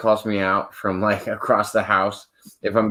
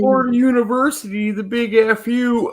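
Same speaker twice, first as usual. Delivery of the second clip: Mad TV catchphrase; 13 semitones higher than usual. Retold or imitated. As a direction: belt out the character's catchphrase. Quoting a Mad TV character.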